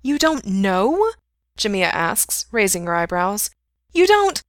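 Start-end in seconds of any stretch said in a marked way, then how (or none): 0.0-1.1 high, drawn-out disbelieving question; incredulous. A narrator's reading. Friend questions him at the bar, incredulous. 3.9-4.4 incredulous. A narrator's reading. Friend presses him, incredulous.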